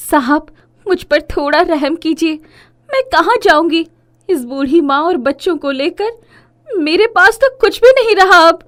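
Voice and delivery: high, anguished acted plea; distraught woman imploring; desperate pleading. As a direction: beg for mercy, rising to a loud cry at the end. Widow begs politicians for mercy.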